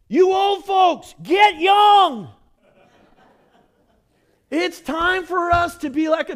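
high, loud preaching shout; loud high rallying cry; exhorting. Real, not acted.

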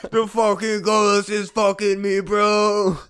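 high, slow hammed-up wail; silly slow ghost voice; mock ghost voice. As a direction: drag it out like a spooked ghost. Joking ghost bit.

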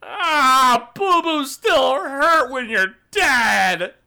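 high, loud, drawn-out whine; hurt monster wailing. Acted. Game master voices the struck monster, pained wail. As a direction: wail it slowly, like a wounded simpleton. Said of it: "and he goes".

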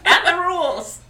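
high, giggling mock battle cry; laughing catchphrase. Co-host parodying Serena's catchphrase, laughing high. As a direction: laugh your way through a hero's slogan.

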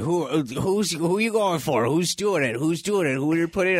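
lightly voiced friends badgering for a pick; pestering question. Friends nagging the speaker about his pick, eager. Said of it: "they're going".

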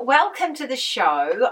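ordinary delivery

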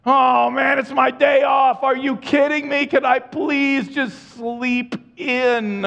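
high, loud mock whining, stretched slowly; whiny complaint. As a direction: complain like a sleepy kid on a day off. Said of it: "Sometimes that goes on inside of my soul".

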